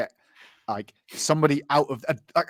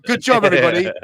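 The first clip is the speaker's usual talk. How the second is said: louder, higher, falsely cheerful praise; mock congratulations; 5 semitones higher, 8 dB louder, faster than usual. Police team praising itself after the fiasco, mock cheerful. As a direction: pat the team on the back sarcastically. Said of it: "Everyone's like".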